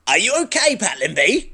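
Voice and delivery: put-on high girly heroine.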